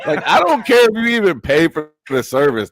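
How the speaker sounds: high laughing disbelief; cracking up while talking, high and loud. Real, not acted.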